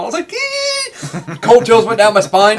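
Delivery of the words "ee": high-pitched fanboy squeal; excited squeal. Retold or imitated. Fan hearing the beloved movie quote, squealing with delight. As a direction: let out a thin, thrilled squeak.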